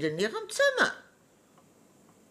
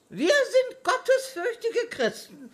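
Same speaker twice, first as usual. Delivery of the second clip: laughing; 9 semitones higher than usual. Acted. Old woman laughing off accusation.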